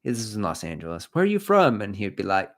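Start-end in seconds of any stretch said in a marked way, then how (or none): 1.1-1.8 high, loud mock-curious question; impressed listeners. People asking Nigel about his accent, mock-impressed. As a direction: imitate an intrigued stranger, pitched high. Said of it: "people were like".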